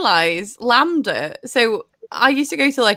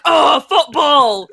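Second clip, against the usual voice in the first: mock football lad; 4 semitones higher, 5 dB louder, much slower than usual. Mocking gammon heads.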